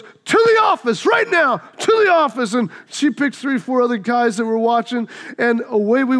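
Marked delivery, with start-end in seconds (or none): 0.0-2.5 high, sharp shout of a teacher; teacher's order. Retold or imitated. Teacher catching boys fighting, imitated angry shout. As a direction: order the kids off to the office. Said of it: "She goes".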